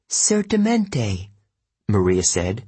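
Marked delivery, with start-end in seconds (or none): 0.1-1.3 high, slow Italian certainty; Italian accent. Acted. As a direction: say Certamente as a native Italian, slowly. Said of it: "She spoke with an Italian accent".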